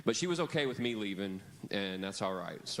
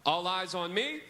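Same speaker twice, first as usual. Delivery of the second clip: loud, rising call for attention; 5 semitones higher, 4 dB louder than usual. Shown as a demonstration. Teacher practising the call with the kids. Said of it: "so let's practice it".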